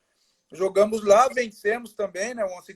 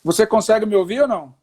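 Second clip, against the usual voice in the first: raised voice over a bad line; calling out, trying to be heard; to someone hard of hearing; 3 semitones higher, 6 dB louder than usual. Real, not acted. Call breaking up, can't hear.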